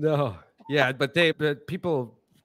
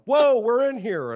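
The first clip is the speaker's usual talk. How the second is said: high, loud, drawn-out mock protest; startled shout; 8 semitones higher, 8 dB louder, slower than usual. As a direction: houseplants crying out in alarm, hammed up. Startled houseplants voiced in shower story.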